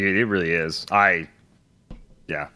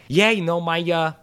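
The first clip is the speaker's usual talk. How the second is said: wife's voice; 8 semitones higher than usual. Retold or imitated. Wife's answer voiced in story.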